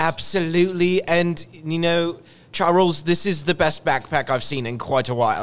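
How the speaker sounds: put-on fancy British accent. Co-host praising the backpack in a light British accent. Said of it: "talk a little bit higher than you normally would".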